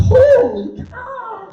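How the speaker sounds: high, stretched-out cry of disbelief; drawn-out, high-pitched alarm; mock shock. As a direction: gape in horror at what you have found. Shocked by hair in the drain.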